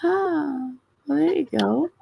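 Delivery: ordinary talk, nothing marked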